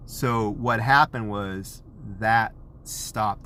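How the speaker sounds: normal talking voice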